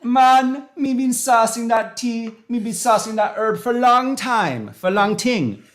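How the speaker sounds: put-on Jamaican accent, high and loud; hammed-up Jamaican patois shout. Tea host jokes to chat in Jamaican accent. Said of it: "It's terrible, the Jamaican accent".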